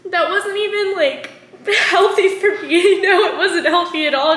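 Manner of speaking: laughing it off through the words. Real, not acted. Vlogger admitting past mistakes, laughing self-consciously. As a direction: giggle through the admission.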